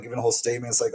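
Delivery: ordinary talk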